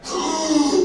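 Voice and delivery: put-on sharp high gasp of a baby's first breath; newborn's gasp. Newborn baby reacting to birth, high shocked gasp. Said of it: "this is how it went".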